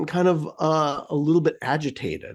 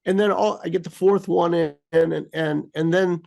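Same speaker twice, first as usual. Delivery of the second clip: ordinary talk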